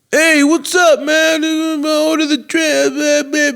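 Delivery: high, strained mock bar-guy voice; imitating the Italian guy. Retelling the loud Italian guy.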